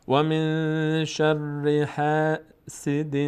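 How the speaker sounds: slow, drawn-out Quran recitation; tajwid recitation. Shown as a demonstration. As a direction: chant it slowly, stretching every vowel. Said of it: "Quran recitation". (تلاوت آرام و کشیده)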